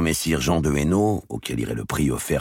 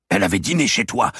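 sharp retort; 7 semitones higher, 5 dB louder, faster than usual. Acted. Heated retort.

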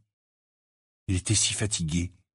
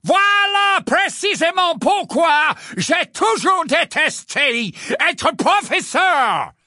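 much higher, louder, slow furious portrait; louder still; 6 dB louder, slower than usual. Acted. Portrait shouting back at Harry, slow and loud. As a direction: outshout the boy, drawn out. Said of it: "d'une voix encore plus forte".

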